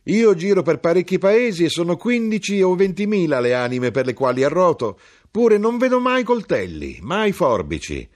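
high, loud, mocking crier; high, loud hawker, slowing at the end; knife-grinder. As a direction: boast loudly in the grinder's voice.

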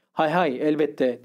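ordinary delivery, nothing marked

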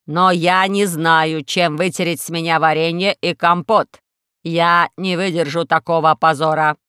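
heavy, deliberate robot lament; glum robot. Acted. Robot complains to Alisa about being sticky, slow and heavy.

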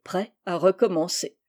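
ordinary delivery, nothing marked

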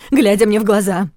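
loud, insistent demand. Acted. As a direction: demand it loudly, face to face. Wife demands truth, voice rising.

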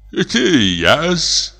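high, meek servant's voice; timid old prompter. A narrator's reading. Old prompter reassuring the frightened comic, high meek voice. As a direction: answer humbly, eager to calm him.

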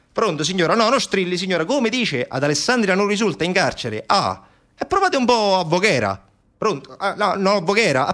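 high, put-on telephone voice; harried phone official. Prison clerks send parents elsewhere, harried brush-off.

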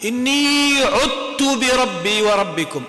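slow tajweed recitation; Quran recitation. Shown as a demonstration. Teacher reciting the example verse to students, slow recitation. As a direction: recite the verse slowly, drawing it out. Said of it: "بمثال آخر".

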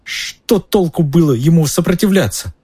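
high, weepy protest; sobbing. A narrator's reading. Pettigrew justifies not resisting, sobbing. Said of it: "всхлипнул Петтигрю".

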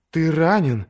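high, sudden worried question; blurted alarm. A narrator's reading. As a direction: let it burst out, startled. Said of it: "вырвалось у неё".

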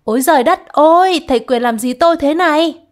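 high, loud wailing cry; crying out for help. Acted. Chị Tam, pushed down by Ván Cách, screams for help. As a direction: wail it so the street hears. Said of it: "Tiếng kêu rầm hàng phố".

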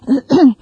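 small dry throat-clearing cough; a fussy lawyer's little cough before speaking. Acted. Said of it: "gave the dry little cough".

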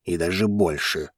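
low, understated male voice; serious reply. A narrator's reading. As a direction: answer in earnest, pitched low. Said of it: "серьёзно отозвался Зейн". (серьёзный ответ низким голосом)

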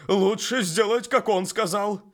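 loud, high horse voice; urgent talking horse. Acted. The horse Bucephalus speaks. (громкий голос коня)

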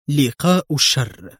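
usual speaking voice

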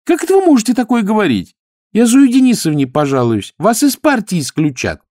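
high, loud, indignant protest; outraged maid, loud and high-pitched. A narrator's reading. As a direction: threaten to report them in shrill outrage. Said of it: "очень натурально возмутилась Манюшка".

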